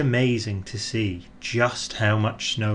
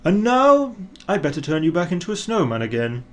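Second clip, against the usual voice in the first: higher start, then quick; brisk wrap-up, faster; brisk Santa; 6 semitones higher, faster than usual. A narrator's reading. Father Christmas telling the snowman, briskly. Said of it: "said Father Christmas".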